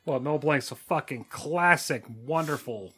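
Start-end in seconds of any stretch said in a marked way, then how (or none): none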